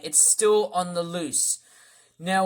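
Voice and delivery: usual speaking voice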